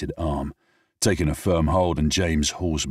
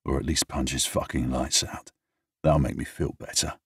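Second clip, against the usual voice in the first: plain reading voice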